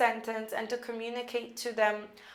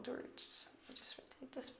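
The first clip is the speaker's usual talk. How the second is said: whispered aside; 3 semitones higher, 19 dB quieter than usual. Real, not acted. Aside to herself mid-lesson.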